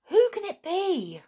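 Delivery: bursting curiosity; a child wondering who it is, pitched high; eager question. Acted.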